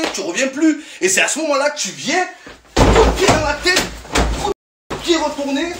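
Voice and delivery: high, eager hammed-up teacher; mock instructor. Comic playing an instructor teaching students, high and eager. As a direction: demonstrate like a cocky coach.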